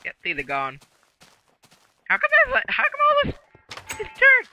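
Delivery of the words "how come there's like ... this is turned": very high falsetto character voice; squeaky put-on voice. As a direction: squeak it out like a tiny mob. Voicing a character in game.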